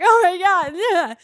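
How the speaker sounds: high, loud, giddy squeal; loud flattered shriek; excited squeal. Retold or imitated. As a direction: squeal with delight at being recognized. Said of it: "I was like".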